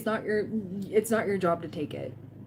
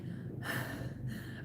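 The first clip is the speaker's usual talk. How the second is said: nervous sigh; 13 dB quieter than usual. Retold or imitated. Nervous about first live stream.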